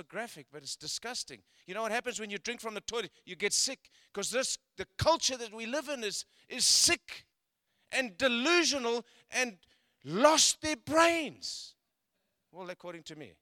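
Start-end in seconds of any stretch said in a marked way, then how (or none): 1.7-11.7 louder and higher, the last words slowed; fervent preaching. Acted. Pastor warning the church about culture, building to a loud slowed peak. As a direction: hammer it home from the pulpit.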